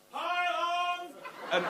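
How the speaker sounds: put-on high cheerful kid voice; a kid bursting in happily; child's greeting. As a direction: call out like a little boy.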